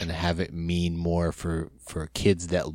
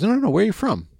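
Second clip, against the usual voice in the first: much higher, insistent question; Howard Finkel's voice; 4 dB louder, faster than usual. Retold or imitated. Finkel insisting on the fan's hometown, much higher. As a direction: press for the real answer, sing-song. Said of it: "He goes".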